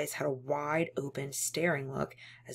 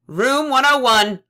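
officer's shout; 8 semitones higher, 16 dB louder than usual. Acted. Officer summons prisoner.

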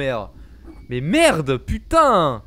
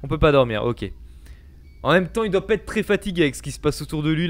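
ordinary talk, nothing marked

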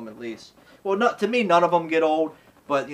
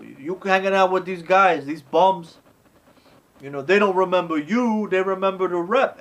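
higher, louder put-on voice, lecturing; imitating lecturing Rocky; 5 semitones higher, 5 dB louder than usual. Rocky lecturing the young girl, higher put-on voice. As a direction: warn her like a big brother.

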